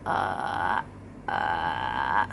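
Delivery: deep creaky rattling hum; vocal fry. Shown as a demonstration. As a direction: let the voice crackle lazily on a low hum. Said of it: "or as an A vowel".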